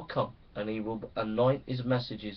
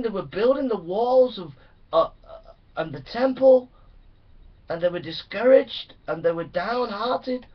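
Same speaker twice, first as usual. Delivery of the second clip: rising, louder, fervent preaching; preaching at full force; 9 semitones higher, 8 dB louder than usual. Acted. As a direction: pound it out like a revival preacher.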